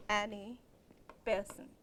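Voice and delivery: ordinary delivery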